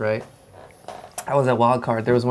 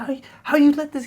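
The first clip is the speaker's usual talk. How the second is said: high, unvoiced hissed scolding; furious father hissing at his son; angry dad; 5 dB louder, faster than usual. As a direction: tear into the son under your breath. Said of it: "he's like".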